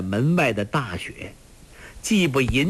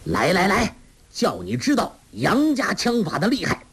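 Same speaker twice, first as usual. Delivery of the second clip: high-pitched, furious dare; enraged, high-pitched battle challenge; angry challenge shouted; 10 semitones higher than usual. A narrator's reading. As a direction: call the enemy out to fight. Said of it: "抢到门外雪地里叫道".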